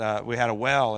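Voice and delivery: ordinary talk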